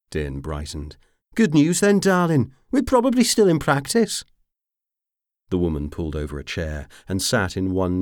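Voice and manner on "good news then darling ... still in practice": much higher, loud gleeful rogue; cheeky delight. A narrator's reading. Dean brightens at the news, gleeful and cheeky. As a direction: perk right up, grinning.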